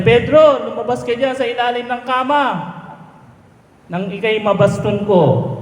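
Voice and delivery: wife ordering husband out from under the bed, high mock scolding; mock angry wife.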